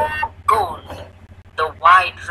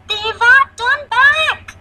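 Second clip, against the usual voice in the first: put-on high, loud child calling out; urgent shout; 8 dB louder than usual. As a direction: shout after a runaway in alarm. Child calls to runaway elephant.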